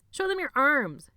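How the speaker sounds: rally the room with a yell; shouting command. A narrator's reading. Benny shouting to the crowd.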